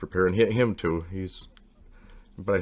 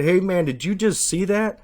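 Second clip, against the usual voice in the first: high, louder excited call; 11 semitones higher, 6 dB louder than usual. Retold or imitated. Narrator calling to his friend after the UFO flash, high and excited. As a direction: call out to him, thrilled and raised.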